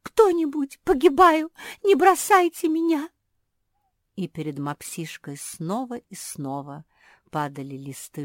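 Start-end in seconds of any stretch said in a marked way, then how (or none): 0.0-3.1 desperate shouting for help. A narrator's reading.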